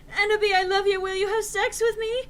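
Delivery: high, loud pleading voice; Snaketail's plea. Acted. Snaketail begging Enderby for love, high and loud. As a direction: beg it high and loud like a lovesick creep. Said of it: "asked Snaketail".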